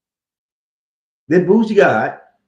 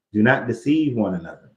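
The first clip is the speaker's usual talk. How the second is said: normal talking voice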